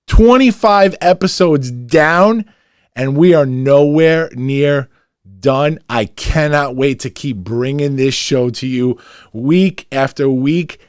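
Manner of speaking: rally the listeners as the show ends; loud sign-off. Real, not acted. Host closing the episode to fans, loud hyped sign-off. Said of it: "I'm gonna get loud like I always do".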